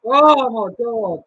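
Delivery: loud, slow, drawn-out exasperation; frustrated groan. Real, not acted. Tipster reacting to a lost bet, loud and slow. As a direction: drag it out in real frustration.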